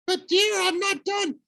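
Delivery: very high coaxing falsetto; pleading falsetto. Acted. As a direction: coax him in falsetto.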